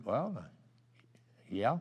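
very soft, halting reply with long pauses; sheepish admission. Retold or imitated. Smoker admitting fault to a lost man, sheepish mumbled reply. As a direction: concede it quietly, reluctant and embarrassed.

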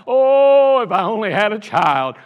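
loud, drawn-out "Oh" of self-pity; hammed-up loud lament; mock wailing. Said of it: "She didn't run around going".

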